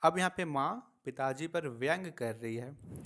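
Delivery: normal talking voice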